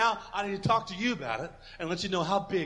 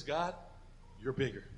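quiet, softer prayer; sincere prayer; 5 semitones lower, 12 dB quieter than usual. Shown as a demonstration. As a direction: tell God gently and sincerely. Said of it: "But what I do, this is".

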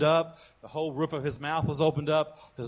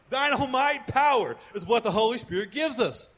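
ordinary talk

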